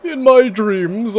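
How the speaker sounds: slow, high theatrical vampire. Acted. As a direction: intone it like a brooding vampire lord. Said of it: "That was my best vampire voice".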